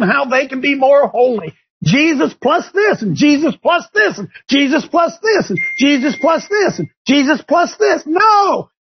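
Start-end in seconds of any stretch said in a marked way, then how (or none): 1.8-8.0 higher, ramping mockery of "Jesus plus this"; mocking build. Real, not acted. Host mocking legalists, building to a shout. 8.1-8.7 sharp, higher "No!"; emphatic no. Real, not acted. Host rejecting legalism, sharp emphatic no.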